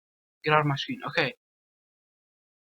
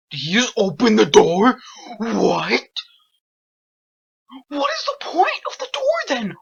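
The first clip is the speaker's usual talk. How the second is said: loud high panicked disbelief; shrieking in shock at the monster; scared horror game streamer; 6 semitones higher, 8 dB louder than usual. Real, not acted. Enemy opened door in game.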